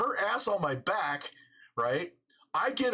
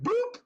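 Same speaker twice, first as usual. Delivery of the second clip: mock bloop. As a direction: pop the word out like a slipping bike. Bike slipping out from under him.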